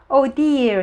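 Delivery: a mild cry of dismay; exclaimed dismay. A narrator's reading. Topsy Turvy hears the sad news, mildly exclaiming. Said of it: "he explained".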